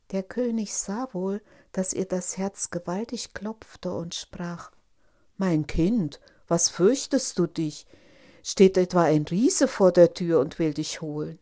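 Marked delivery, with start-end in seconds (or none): 5.4-11.4 lightly voiced king, firm and loud; questioning king. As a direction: question your child with fatherly authority, lightly acted. (leicht angedeuteter König, kräftig fragend)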